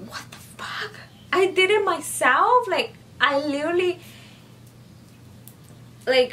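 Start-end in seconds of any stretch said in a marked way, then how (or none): 0.1-0.9 breathless whispered disbelief; hushed stunned curse; shocked whisper. Retold or imitated. Shocked she escaped alone. 1.3-2.7 high, loud stunned question; pitched-up astonished cry; shocked disbelief. Retold or imitated. Shocked she escaped alone.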